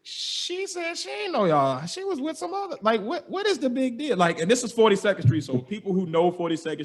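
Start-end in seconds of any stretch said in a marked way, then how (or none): 0.0-4.2 incredulous. Retold or imitated. Teller replaying his disbelief at the gate, high and exasperated. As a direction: pitch it way up in baffled protest. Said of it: "I'm looking like".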